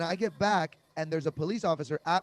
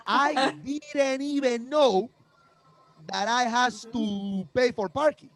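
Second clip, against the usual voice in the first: put-on higher, slow broken-English foreign accent; fake foreign accent; 5 semitones higher, slower than usual. Comedian to parking cop, pleading in a fake foreign accent. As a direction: sound like a lost foreigner pleading innocence. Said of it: "this accent I was doing".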